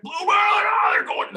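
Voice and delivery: very high, loud screaming; excited scream. Retold or imitated. Charter captain screaming as the marlin jumps, loud. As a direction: lose it at the sight of the fish.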